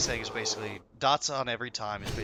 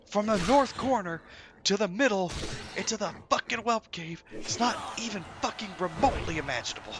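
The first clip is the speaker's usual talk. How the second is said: high, loud ranting; mock tirade; 10 semitones higher, 5 dB louder than usual. Raid leader ranting at Crushing, high angry tirade. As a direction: rant at the player who messed up. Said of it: "He goes on this fucking tirade".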